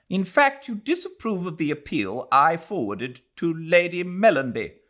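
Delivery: high, loud indignant lady; heated. Acted. Mrs Turton rebuking Mr Heslop, loud and heated. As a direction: bristle with offended heat. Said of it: "with considerable heat".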